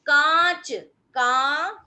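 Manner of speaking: "कांच" then "का", loud, the aa nasalized; nasal vowel demo. Shown as a demonstration. Teacher demos the chandrabindu nasal sound in "कांच". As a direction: sound "का" through both nose and mouth. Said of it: "यानी नाक से भी आवाज आ रही है".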